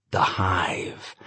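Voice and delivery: breathy whisper of the hive. A narrator's reading. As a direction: whisper it like an echo. Repeated chant, whispered.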